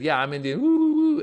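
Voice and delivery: joking comeback ending in high, loud whoops; imitated playful retort with a high "woo, woo"; playing along. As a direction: go along with the joke, then whoop. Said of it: "she kinda laughed, and she said".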